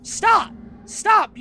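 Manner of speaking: panicked real yelling of stop; frantic shouting. Real, not acted. Begging monster to stop.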